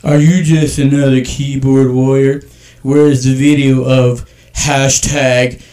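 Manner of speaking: slow, loud, deliberate read. Shown as a demonstration. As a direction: read it out slow and heavy, mocking. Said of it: "I'm gonna read this in a slow voice".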